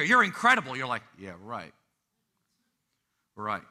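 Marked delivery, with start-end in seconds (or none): 0.0-0.8 loud, high-pitched mock proclamation; emphatic affirmation. Preacher affirming a doubting listener, emphatic proclamation. 1.2-3.6 low, soft, deadpan doubt; skeptical dismissal. Acted. Listener doubting they are incredible, muttered skeptical reply.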